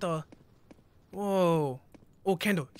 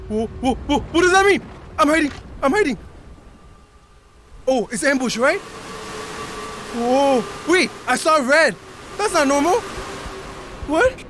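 high frantic go back; panicked; 7 semitones higher, 6 dB louder than usual. Real, not acted. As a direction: scramble away in fright. Panicking at red monster.

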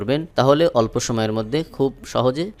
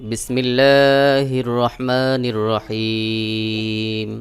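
slow, melodic tilawat; Quran recitation; much slower than usual. Acted. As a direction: chant each word long and even. (ধীর সুরেলা কুরআন তিলাওয়াত)

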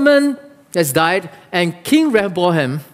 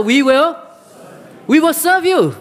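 building louder, rising higher; 8 semitones higher than usual. Shown as a demonstration. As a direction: call it out louder, with rising pitch.

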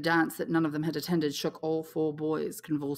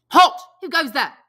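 louder, higher sentry call; sharp challenge; 10 semitones higher, 14 dB louder than usual. A narrator's reading. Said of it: "The voice rang out sharply".

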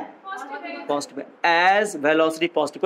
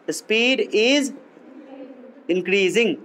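"speed is", a long pause, then "increasing"; slow dictation; much slower than usual. Shown as a demonstration. Teacher repeating the answer for note-takers, slow dictation. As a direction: dictate it in separate, measured chunks.

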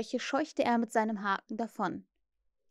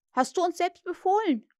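higher, mildly flustered protest; stammering; 8 semitones higher, 4 dB louder than usual. A narrator's reading. Bosun defends himself to furious Hook, mildly flustered. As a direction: answer back, a touch flustered. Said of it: "stammelte der Bootsmann".

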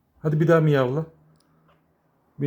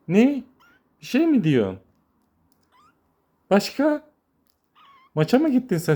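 high teasing coo; 11 semitones higher than usual. Real, not acted. Teasing the kitten that won't meow, high coo. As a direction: coax the silent kitten playfully.

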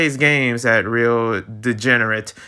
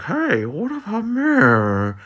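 pitched-up sulky gadget begging for attention; Switch Lite's voice; 11 semitones higher than usual. Acted. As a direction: plead like a lonely toy left behind. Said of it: "That's my voice for it".

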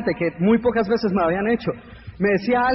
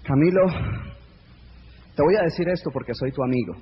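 lower, softer, condescending; patronizing friend; 7 semitones lower, 3 dB quieter than usual. Pretend. A friend warning Camilo about his dreams, lower and patronizing. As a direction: talk down gently like a know-it-all friend.

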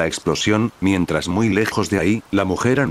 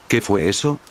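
ordinary delivery

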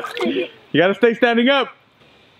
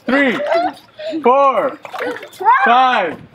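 referee count; 7 semitones higher, slower than usual. Acted.

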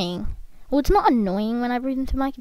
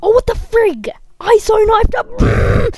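high, loud protest at the game; outraged yell; 10 semitones higher, 6 dB louder, slower than usual. Real, not acted. Player to viewers after a failed knifing, outraged yell. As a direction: yell it in disbelief at being robbed.

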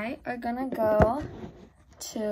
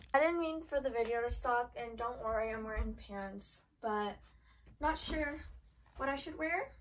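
quiet, away from camera; 9 dB quieter than usual. Real, not acted. Talks low, away from mic.

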